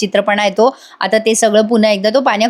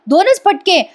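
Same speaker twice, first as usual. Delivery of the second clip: दोनच said much higher, questioning; mock disbelief; 9 semitones higher, slower than usual. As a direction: leap up on दोनच as if shocked. [name] goads Anna. (दोनच वर उंच आवाजात, नकली आश्चर्य)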